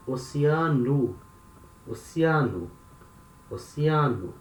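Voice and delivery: oceano ending in an oo sound, "o-see-AH-noo"; final o of oceano said as u; Portuguese word. Shown as a demonstration. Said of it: "Pay attention".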